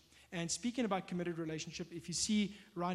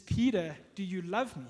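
Peter's name called out louder, then the question pressed home; pressing question; 6 dB louder than usual. Retold or imitated. Jesus pressing Peter a third time, calling his name louder.

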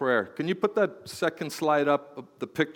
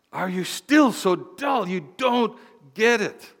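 exasperated Jesus; 5 semitones higher, slower than usual. Acted. Pastor voicing Jesus again.

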